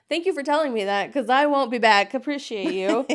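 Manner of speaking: loud, high mock gratitude; sarcastic thanks. Thanking the doctor who threatened to keep her, sarcastic. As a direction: thank him with bright fake politeness.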